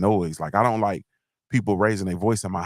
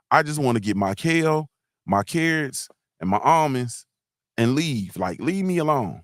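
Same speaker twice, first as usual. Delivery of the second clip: ordinary talk, nothing marked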